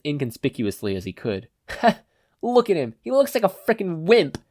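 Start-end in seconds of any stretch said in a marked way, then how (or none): 1.7-4.4 high, sneering snicker of a sleazy thug; high mocking thug voice, snickering; snickering sleaze. A narrator's reading. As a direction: sneer it down at the new kid, mocking. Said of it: "snickered a sleazy-looking guy".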